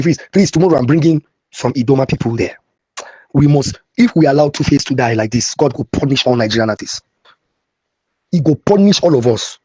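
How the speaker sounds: normal talking voice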